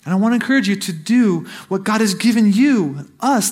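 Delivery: normal talking voice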